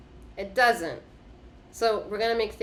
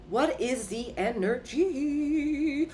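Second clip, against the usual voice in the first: higher and slow, stretching each word; slow, drawn-out question; 7 semitones higher, slower than usual. Real, not acted. Reader to viewers about the card, slow drawn-out question. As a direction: ask it slowly like a riddle.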